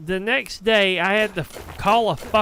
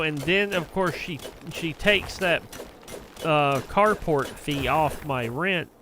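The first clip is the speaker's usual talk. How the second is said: ordinary talk, nothing marked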